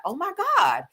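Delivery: high-pitched mock disbelief; imitating a shocked fan; shocked gasp. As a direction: act stunned by the news. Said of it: "And you just be like".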